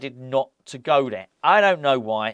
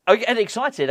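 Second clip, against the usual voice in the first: higher, excited burst; 8 semitones higher, 4 dB louder than usual. Real, not acted. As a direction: let real excitement lift the pitch. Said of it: "I am getting very, very excited".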